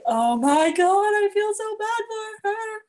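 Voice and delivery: high, loud gushing "oh my God"; mock shocked sympathy. Friend retelling her shocked reaction, high and loud. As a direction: ham up the shock, voice way up. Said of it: "I was like".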